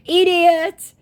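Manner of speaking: high, mocking put-on voice for the scammer; whiny high imitation of a chat scammer.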